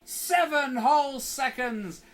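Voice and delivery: slow, loud, high exclamation; mock triumphant shout. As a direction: announce it like a big win. Pop-in finally arrives.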